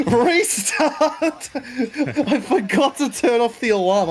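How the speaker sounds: laughing while confessing a blunder. Real, not acted. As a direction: laugh through the words. Laughing at own mistake.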